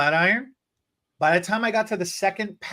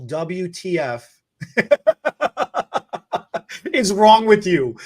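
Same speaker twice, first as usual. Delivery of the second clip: retold loud outburst; 4 dB louder, slower than usual. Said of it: "the loudest audible".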